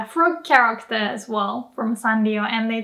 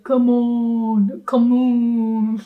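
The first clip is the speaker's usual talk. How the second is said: whiny complaining; much slower than usual. Retold or imitated. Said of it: "complaining or they're frustrated".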